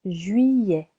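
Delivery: ordinary talk, nothing marked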